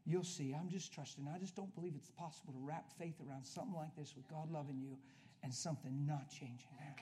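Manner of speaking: normal talking voice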